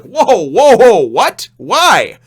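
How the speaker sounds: loud, high shocked exclamation; loud mock astonishment; shocked whoa. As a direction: blurt it out in disbelief. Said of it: "And you're like".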